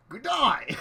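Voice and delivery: loud, high, cheery Aussie greeting; over-the-top Australian hello; Australian-ish accent. Pretend. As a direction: shout it like a hammed-up Australian. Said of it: "my terrible Australian-ish accent".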